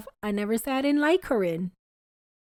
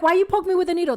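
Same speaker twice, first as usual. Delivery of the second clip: higher, louder mock complaint; mock whine; 6 semitones higher, 4 dB louder, faster than usual. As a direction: play the fake victim, hammed-up. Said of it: "and then you're like".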